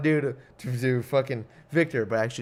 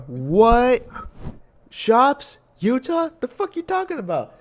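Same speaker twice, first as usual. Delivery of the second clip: incredulous; 9 semitones higher, 4 dB louder, slower than usual. Acted. Mock disbelieving reply to himself.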